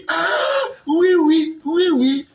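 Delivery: hammed-up eager agreement; mock eager yes. Journalist feigning eager agreement, high drawn-out oui oui.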